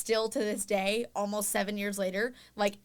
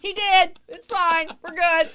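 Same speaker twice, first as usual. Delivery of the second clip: nervous cover-up; 8 semitones higher, 8 dB louder, slower than usual. Retold or imitated.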